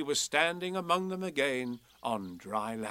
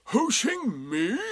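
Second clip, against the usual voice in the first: drawn-out, high, raised question; Eeyore, surprised; 9 semitones higher, 7 dB louder, much slower than usual. A narrator's reading. Donkey still querying the rabbit, slow and surprised. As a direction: repeat it slowly in disbelief. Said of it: "said Eeyore in surprise".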